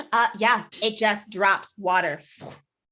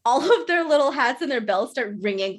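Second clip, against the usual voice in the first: ordinary talk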